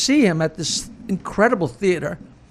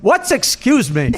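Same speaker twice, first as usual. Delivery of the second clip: a hint of a loud, slow punchline yell, laughing; 4 semitones higher, 4 dB louder, slower than usual. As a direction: punch the last line out, laughing at his own joke.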